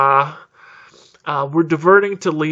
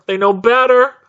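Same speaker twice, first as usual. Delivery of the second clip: higher, louder, insistent outburst; exasperated repeat; 8 semitones higher, 6 dB louder than usual. Real, not acted. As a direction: hammer it home, high and loud.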